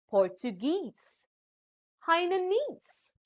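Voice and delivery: "Portuguese, Hainanese" landing on GUESE and NESE; last-syllable stress. Shown as a demonstration. Teacher giving examples of ending ease, "Portuguese, Hainanese" stressed at the end. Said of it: "usually carry stress on the last syllable".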